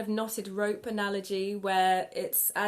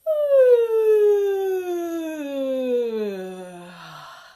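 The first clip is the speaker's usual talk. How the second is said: loud open yawning sigh falling from high; big yawn; 7 semitones higher, 11 dB louder than usual. Shown as a demonstration. Teacher demonstrates a big warm-up yawn, loud yawning sigh. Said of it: "a nice big yawn".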